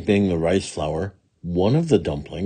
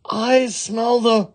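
high, slow demon cry; insistent wicked Oni; slower than usual. Acted. Wicked Oni insisting to Jizo, high drawn-out cry. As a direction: draw it out high as the ogre.

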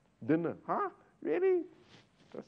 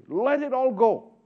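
louder, higher, slow call; forceful plea; 10 semitones higher, 10 dB louder than usual. Real, not acted. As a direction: proclaim it slowly, with force. Preacher urges giving up desire.

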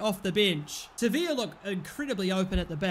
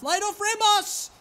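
urgent call; 10 semitones higher, 5 dB louder than usual. Real, not acted. Calling out to player.

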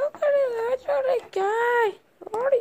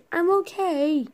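ordinary talk, nothing marked

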